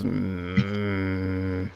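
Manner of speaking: low, soft doubtful hum; a quiet unconvinced mm; skeptical grunt. Acted. Dwarf unconvinced by the adventurer's excuse, low skeptical hum. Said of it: "He just kinda goes".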